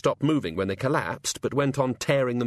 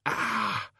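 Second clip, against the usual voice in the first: a sudden higher "ah, ouch" of pain; pained yelp; 12 semitones higher, 3 dB quieter than usual. Acted. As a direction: yelp as the headache stabs. God winces again.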